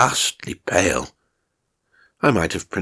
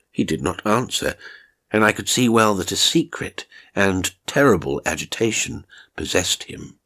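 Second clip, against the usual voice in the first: ordinary delivery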